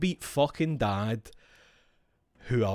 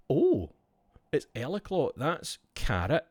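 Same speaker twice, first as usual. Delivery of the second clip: ordinary talk, nothing marked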